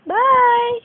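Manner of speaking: loud, high farewell call; calling goodbye. Real, not acted.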